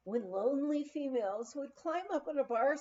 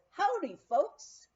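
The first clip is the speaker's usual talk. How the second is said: cowboy greeting; 8 semitones higher, 5 dB louder, slower than usual. Retold or imitated. Cowboy bartender greets Texans.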